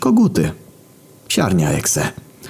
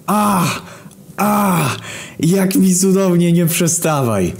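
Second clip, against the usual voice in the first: high, slow, moaning woman's voice; moaning through the wall; 12 semitones higher, slower than usual. Acted. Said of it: "I za chwilę znowu za ścianą".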